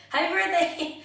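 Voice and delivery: high, loud imitation of mom's birthday call; cheery birthday greeting. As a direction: exclaim it like an excited well-wisher on the phone.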